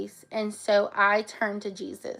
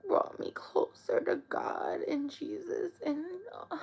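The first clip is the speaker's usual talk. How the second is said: real tears, softer and higher; crying; 6 semitones higher, 6 dB quieter than usual. Real, not acted. Telling viewers what Daisy did, real crying. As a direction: speak through crying. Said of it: "I'm getting emotional".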